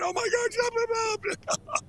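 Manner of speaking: very high-pitched mock panic; Sean Hannity. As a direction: imitate a horrified TV host calling out in alarm. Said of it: "Sean Hannity would be going".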